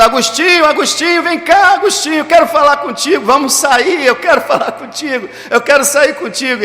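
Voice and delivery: high, urgent pleading calls of a pursuing woman; imitated calling out. Former lover running after Agostinho at the market, imitated calling out. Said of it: "saiu correndo atrás dele gritando".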